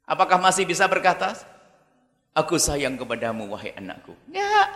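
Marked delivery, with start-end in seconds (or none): 2.3-4.1 low, soft mock tenderness; a fading, hushed mother's tenderness; mock tender mother. Mother speaking tenderly to child. (ibu berbisik lembut)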